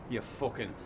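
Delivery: usual speaking voice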